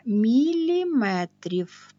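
МІЛІМЕТРІВ drawn out slowly for emphasis; emphatic slow word. Real, not acted. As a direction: stretch міліметрів out so pupils note the unit. Teacher flags the unit after "attention. (міліметрів повільно, розтягнуто, з наголосом на важливому)

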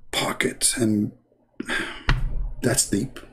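"Pocket" whispered with fake concentration; hushed mock-serious "Pocket"; mock intense whisper. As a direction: breathe out "Pocket" like a deep thought. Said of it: "Look how deep he is".

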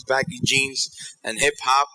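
ordinary talk, nothing marked

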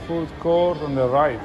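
ordinary talk

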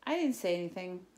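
ordinary delivery, nothing marked

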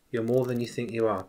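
hushed recollection of the prophecy; soft remembered words. Retold or imitated. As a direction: murmur it as a treasured memory.

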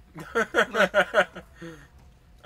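loud, high, rolling laugh; long burst of cackles. Real, not acted. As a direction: crack up at your own joke. Laughs at own joke about alien.

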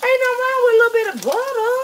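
high, loud sassy clapback; high sassy reply, a little fast; sassy high retort. Retold or imitated. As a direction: snap back high at Facebook family. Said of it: "I be like".

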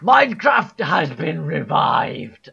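slow, high, loud announcement; mock proclamation. Streamer announces Minecraft is revived, mock-grand proclamation.

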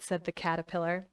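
ordinary delivery

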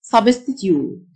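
British "substitute", ending in a tyoot glide; British-style substitute, "-tyoot"; British pronunciation. Shown as a demonstration. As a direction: say substitute the British way, with a y-sound before the final oot. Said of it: "وده النطق البريتش".

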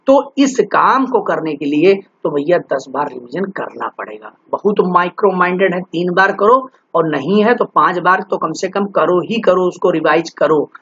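ordinary delivery

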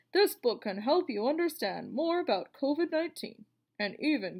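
lively, expressive read-aloud voice; reading to children. Acted. Teacher reading a covid book aloud to her class, lively storybook voice. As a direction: read it like a picture book for kids.

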